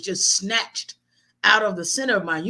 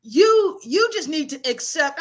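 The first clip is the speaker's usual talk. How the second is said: scolding grandmother; 2 semitones higher, slower than usual. Retold or imitated. Grandmother scolding her grieving grandson, blunt. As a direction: lay down the law like a blunt grandmother.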